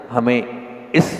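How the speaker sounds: ordinary talk, nothing marked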